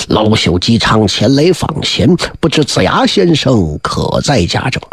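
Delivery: put-on low, slow, humble old man's voice; bow low and ask gently; humble old king. Old King Wen humbly asks the boy, slow and low.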